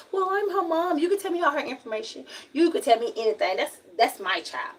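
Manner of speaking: a high, fast mock of a pushy mother; mocking mom. Daughter mimicking mom claiming rights to her info, mock high voice. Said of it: "be like".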